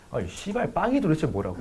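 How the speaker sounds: hushed, sweary grumble to oneself; inner grumble. Retold or imitated. Boyfriend privately cursing about bread while apologizing, quiet inner grumble. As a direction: keep the exasperation under your breath. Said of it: "속으로 그 생각했어".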